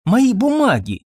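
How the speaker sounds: high, urgent answer; insistent doctor. A narrator's reading. Doctor answers impatient woman about his papers, insistent.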